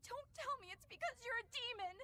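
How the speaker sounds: high-pitched crying reproach; tearful protest. Acted. Companion reproaches a demon for not grieving, crying. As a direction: plead through tears, demanding an answer. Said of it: "nothing to shed tears over".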